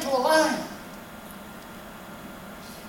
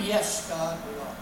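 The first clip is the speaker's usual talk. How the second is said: much lower voice answering God; smug worshippers; 9 semitones lower, 9 dB quieter than usual. Acted. People answering God, lower and self-satisfied.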